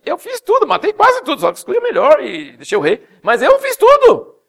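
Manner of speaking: high, loud defensive insisting; mock excuse-making Saul. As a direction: plead innocence like a caught boy. (Saul se defendendo, voz aguda)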